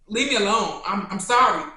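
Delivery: loud mock surrender; mock pleading. Imagining herself backing out of a rap battle, loud mock pleading. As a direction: beg off loudly, hammed-up.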